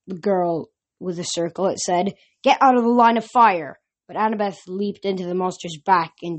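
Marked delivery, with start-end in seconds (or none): 2.4-3.8 commanding. Acted. Huntress commands.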